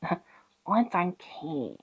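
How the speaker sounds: slow, soft witch quote; witch impression, muttered. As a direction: draw it out quietly like a witch. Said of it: "And the witch is like".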